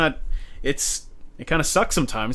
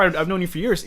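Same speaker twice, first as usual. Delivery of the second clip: ordinary delivery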